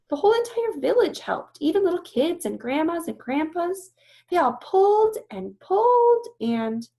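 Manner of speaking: put-on high, loud young elephant voice; a young girl's earnest protest; Ruby pleading. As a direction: insist eagerly in a small high voice. Ruby passionately defending humans.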